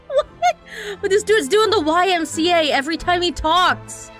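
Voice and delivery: high, cracking laugh-talk; laughing disbelief. Real, not acted. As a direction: laugh through the words. Laughing at pose.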